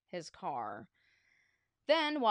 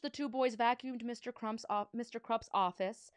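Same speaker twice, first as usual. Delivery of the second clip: plain reading voice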